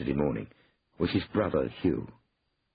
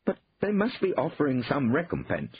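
higher, urgent plea; mildly desperate; 10 semitones higher than usual. A narrator's reading. Brother hopes for some recompense, a touch desperate. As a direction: grasp at a last hope. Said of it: "said Hugh desperately".

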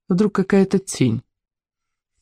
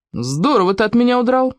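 impressed exclamation; 7 semitones higher than usual. A narrator's reading. A policeman addresses the runaway boy, impressed. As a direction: voice swooping high in mock admiration.

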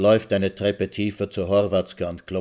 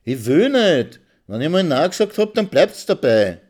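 loud, higher, fast in the middle; angry refusal; 8 semitones higher, 7 dB louder than usual. Acted. Wife shouting no at her pushy husband, loud and angry. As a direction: slam the door on him with your voice.

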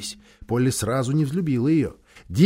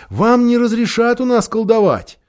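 hasty; 6 semitones higher, 3 dB louder than usual. A narrator's reading. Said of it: "поспешно заверил Дигори".

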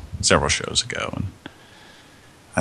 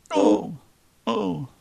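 high strained oh sounds; high groaning oh; 14 semitones higher, 4 dB quieter than usual. Shown as a demonstration. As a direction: moan two high oh sounds. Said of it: "sound a little bit like this".

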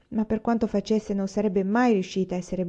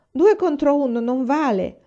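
a louder, higher, slow objection; objecting; 7 semitones higher, 7 dB louder than usual. A narrator's reading. Mary objects to her sisters teaming up, raised slow protest. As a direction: protest it's unfair, pitched up and pushed. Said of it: "obiettò Mary".

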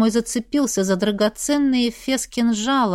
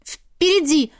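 higher-pitched urgent pointing; lightly voiced alarmed girl; agitated girl; 6 semitones higher, slower than usual. A narrator's reading. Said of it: "указала она пальцем".

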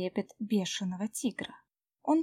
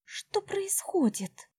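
alarmed; 11 semitones higher, slower than usual. Acted. Джу in panic whispers.

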